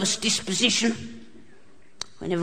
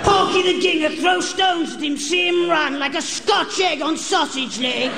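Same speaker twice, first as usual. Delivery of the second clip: mock him like playground bullies; schoolyard taunt; 6 semitones higher than usual.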